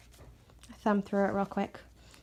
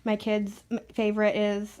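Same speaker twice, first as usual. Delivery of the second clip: normal talking voice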